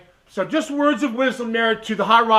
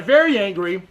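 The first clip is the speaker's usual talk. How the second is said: ordinary talk, nothing marked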